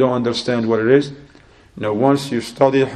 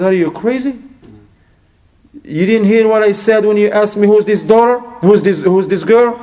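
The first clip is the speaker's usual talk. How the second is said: high, indignant rant getting louder; acted fury; 8 semitones higher, 4 dB louder than usual. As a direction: play the outraged father scolding his guest. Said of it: "got very angry and furious".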